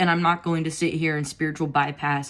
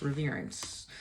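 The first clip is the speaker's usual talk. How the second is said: softly repeated; 4 semitones lower, 13 dB quieter than usual. Real, not acted.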